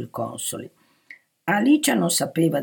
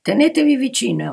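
loud, high commanding voice; order; 8 semitones higher, 7 dB louder than usual. A narrator's reading. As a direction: order him sharply to obey. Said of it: "ordinò". (ordine perentorio a voce alta)

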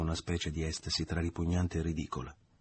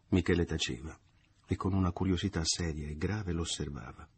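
plain reading voice

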